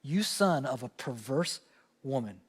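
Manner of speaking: drags out the insult, dropping low and soft at the end; slow, low mock curse. A pastor reenacting Saul's curse on Jonathan, low and slow. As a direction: Saul cursing his son, in a slow low mock snarl. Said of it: "this is a curse".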